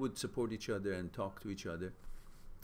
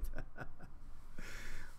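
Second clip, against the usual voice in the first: breathy trailing chuckle; amused chuckle. Real, not acted. Amused by a viewer's spaghetti comment, chuckling. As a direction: let the chuckle tail off.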